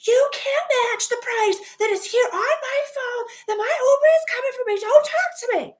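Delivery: retelling yelling at pushy cabbies at the airport; screaming at cabbies. Retold or imitated. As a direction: yell them off in a high strained voice. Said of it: "we're screaming at each other".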